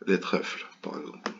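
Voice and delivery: muttered under the breath, barely voiced; soft whispered afterthought; whispered aside. Real, not acted. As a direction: drop to a whisper as if to yourself. Aside repeating his own words.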